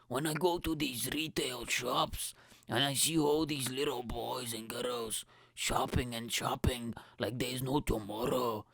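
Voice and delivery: mock Scottish rant, Hulk-style; Hulk-Scottish mix. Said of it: "sound like a mixture between Hulk and a Scottish guy".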